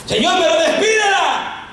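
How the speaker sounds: high, insistent protest; exasperated plea. Acted. Disciples insisting Jesus send her away, exasperated.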